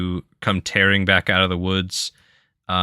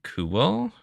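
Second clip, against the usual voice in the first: ordinary talk, nothing marked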